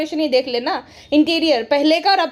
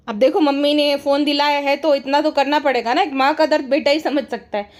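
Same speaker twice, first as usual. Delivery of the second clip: ordinary talk, nothing marked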